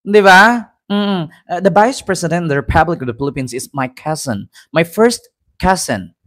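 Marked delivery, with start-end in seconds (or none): 1.6-6.1 softly put-on British accent; a hint of imitation British English; mock British accent. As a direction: try a mild British accent, understated. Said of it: "hindi tayo mag-British accent".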